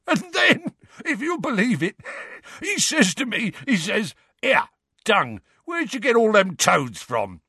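high, strained voice breaking into laughs; Mundungus, choked with laughter. A narrator's reading. Rogue telling friends how he swindled Will, choked with laughter. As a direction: tell it through helpless laughter, pitched right up. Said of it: "choked Mundungus, tears running down his face".

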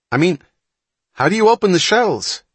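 almost yelping the question; loud. A narrator's reading. Wapner still loudly pleading.

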